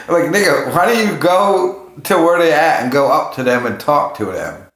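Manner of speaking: ordinary talk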